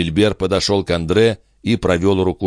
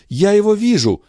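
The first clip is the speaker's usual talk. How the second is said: much higher, louder rapt cry; mother spotting her son in a vision, higher and louder; excited cry; 4 dB louder than usual. Acted. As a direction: cry out on seeing him. Seer sees him, rising panic.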